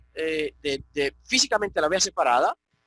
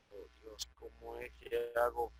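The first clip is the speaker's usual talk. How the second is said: ordinary talk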